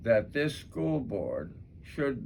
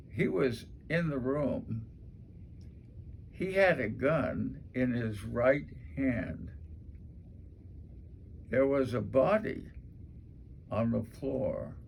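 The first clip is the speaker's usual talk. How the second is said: long pauses between each short line; dramatic pauses; 3 dB quieter than usual. Shown as a demonstration. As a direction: let each line land, then stop. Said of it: "But a pause".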